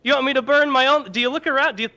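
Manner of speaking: a poor man losing his only goat, hammed up; exasperated rant. Mano outraged over his only goat.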